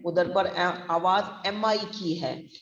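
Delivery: usual speaking voice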